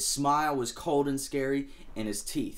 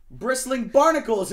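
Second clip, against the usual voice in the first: high, loud shocked cry; exclamation; 11 semitones higher, 9 dB louder than usual. Acted. Lobster shocked as the angelfish vanish, loud high cry. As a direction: blurt out in shock. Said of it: "exclaimed Lobster".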